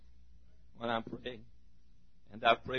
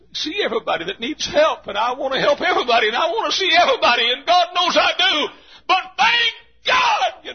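high, loud, ringing preacher's shout; full-force preaching; 12 semitones higher, 10 dB louder than usual. Acted. Preacher calling his people to God's throne, shouting high. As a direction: preach it at the top of your lungs.

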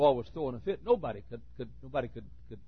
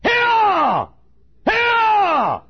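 commanding shout; 16 dB louder than usual. Retold or imitated. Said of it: "jerked my head and said".

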